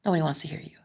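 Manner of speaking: low, soft mock put-down; imitated haters. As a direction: quote the haters low, sinking towards a whisper. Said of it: "people that just tell me".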